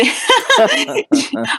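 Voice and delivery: bright, high burst of real laughter. Real, not acted. Dating coach laughing at not knowing her own channel name, bright laugh.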